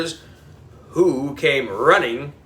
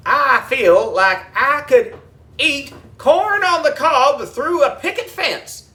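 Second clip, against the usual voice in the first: folksy country drawl; 10 semitones higher, slower than usual. A narrator's reading. Folksy groundskeeper voice.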